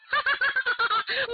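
high, ringing cackle; villainous laugh. Acted. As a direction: laugh in triumph before unmasking. Villain reveals true identity. (得意地尖声狂笑)

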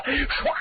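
quick high whoosh of a blade; storyteller's blade sound effect; imitated sword swish. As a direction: make the sword's swish with your mouth. Imitates sword being raised.

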